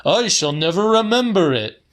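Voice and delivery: put-on high, loud and quick old-man voice; old man's mock lament. A narrator's reading. Mister Kelly echoes the pensioner, loud and high. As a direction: rattle it off like a cranky old man. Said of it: "said Mister Kelly".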